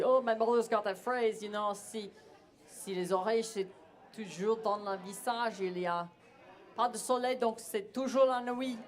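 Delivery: careful amateur French, lifted pitch; attempt at French. Quoting his mother's saying, English-accented French. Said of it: "That's my attempt at saying it in French".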